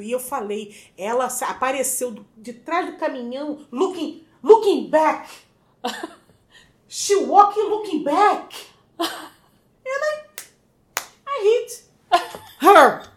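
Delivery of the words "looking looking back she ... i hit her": put-on loud, halting English in a Brazilian accent; Brazilian-accented English, high, with long gaps between words; heavy Brazilian accent. Immigrant explains to police how she hit someone, halting Brazilian accent. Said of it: "vai ter que ser desse jeito".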